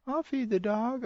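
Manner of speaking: put-on very high-pitched kid voice; imitating a child, far above own pitch; child's answer. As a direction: squeak out a child's eager reply.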